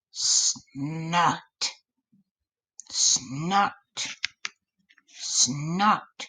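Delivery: animal snorts; snuffling snorts; snorting. A narrator's reading. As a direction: snort like a big beast. Creature approaching.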